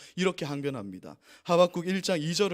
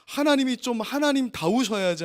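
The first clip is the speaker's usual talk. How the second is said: raised demand; 7 semitones higher, 5 dB louder than usual. Retold or imitated. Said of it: "소리쳐요".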